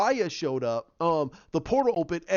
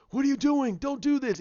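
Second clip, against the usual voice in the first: alarmed; 9 semitones higher than usual. Retold or imitated. Alarmed hero stops the sacrifice.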